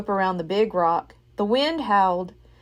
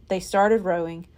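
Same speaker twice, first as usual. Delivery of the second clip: usual speaking voice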